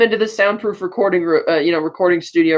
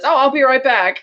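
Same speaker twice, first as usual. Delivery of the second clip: higher-pitched, tossed-off mock promise; breezy excuse; 6 semitones higher than usual. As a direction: toss it off as an easy exit line. Imagined Bond casually leaving lovers.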